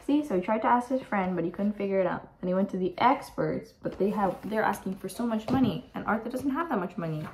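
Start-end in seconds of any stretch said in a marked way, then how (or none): none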